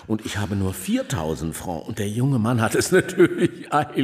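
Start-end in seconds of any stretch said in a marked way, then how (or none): 1.9-4.0 slight nervous laugh through the words. Acted. Father pleading to Saccard for money, laughing nervously. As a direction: chuckle it out, a little embarrassed.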